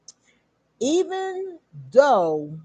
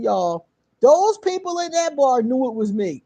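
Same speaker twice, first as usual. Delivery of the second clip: ordinary delivery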